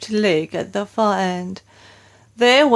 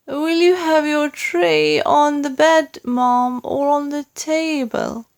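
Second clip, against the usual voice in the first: maid; 7 semitones higher than usual. A narrator's reading. Maid serving the Queen breakfast.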